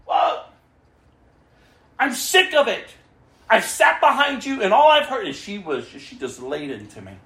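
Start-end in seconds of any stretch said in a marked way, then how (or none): 0.0-5.2 angry shout. Retold or imitated. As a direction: shout her down like an outraged diner. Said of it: "She goes".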